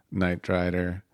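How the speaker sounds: ordinary delivery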